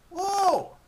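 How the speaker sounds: loud, high whoa of delight; excited dog. A narrator's reading. Dog hearing she got the steak, excited dog whoa. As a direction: burst out with doggy joy. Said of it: "The tail wags furiously".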